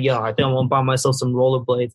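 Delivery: low rapper impression. Rapper announcing he wants rollerblades, low imitated voice. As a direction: drop the pitch to imitate a rapper. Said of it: "that clip of [name] like".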